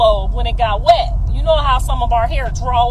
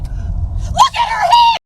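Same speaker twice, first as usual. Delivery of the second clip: very high, drawn-out screech; Jonathan's mock yell; slower than usual. Son yelling at his mom about his date, screeched. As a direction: imitate an embarrassed teen screeching in protest. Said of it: "he yelled at me".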